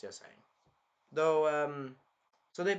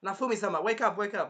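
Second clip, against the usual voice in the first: put-on higher, urgent girlish pleading; Raftalia; 7 semitones higher, 4 dB louder than usual. Raftalia waking Naofumi, urgent high imitation. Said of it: "the expression of Raftalia".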